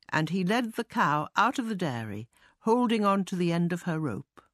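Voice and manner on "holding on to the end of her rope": plain reading voice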